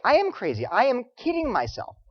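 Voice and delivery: usual speaking voice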